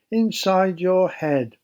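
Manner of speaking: ordinary delivery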